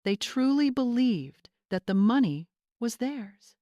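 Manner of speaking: plain reading voice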